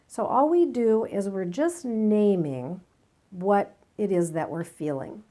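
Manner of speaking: ordinary talk, nothing marked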